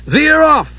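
high, urgent yelled command; yelling. A narrator's reading. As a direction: call it out sharply, pitched right up. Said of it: "Steele yelled to Flare".

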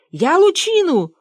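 high, loud, drawn-out boast; indignant boaster. Acted. Fyodor Kuzmich boasts of inventions to his pursuers, high indignant voice. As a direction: stretch it out high, insisting on credit.

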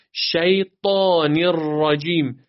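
slow, drawn-out Quran recitation; measured recitation. Shown as a demonstration. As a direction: recite شیطان الرجیم with long held vowels. Teacher demonstrates Quran recitation. (تلاوت کشیده و شمرده)

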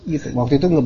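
normal talking voice

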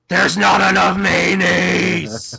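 high, drawn-out, distorted cry; long, high strained shriek; mock ghostly yell. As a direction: wail it out slowly for a comic scare. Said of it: "the woman apparently smiled and said".

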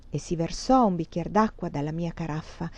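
usual speaking voice

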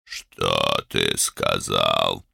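very slow, very low threat; menacing growl. A narrator's reading. Older brother challenging younger's mockery, slow menacing growl. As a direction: growl it slowly, dragging every word. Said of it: "медленно и грозно прорычал старший".

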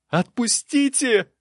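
very high, drawn-out desperate shout; desperate cry. A narrator's reading. Novice struggling in the guards' hands, desperate cry. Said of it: "отчаянно закричал он".

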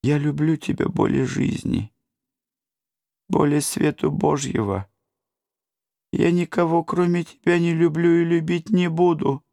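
high sobbing lament; tragic heroine sorrow. A narrator's reading. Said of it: "она рыдала всё громче".

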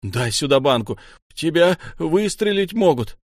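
alarmed yelling, climbing at the end; urgent shout. A narrator's reading. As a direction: shout sense into him. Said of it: "Кричу ему".

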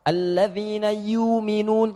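slow chanted Warsh reading with long vowel in yu'minuna; slow melodic chant in the Warsh style; Warsh recitation. Shown as a demonstration. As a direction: recite "yu'minuna" drawn long without the hamzah. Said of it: "Dibaca panjang".

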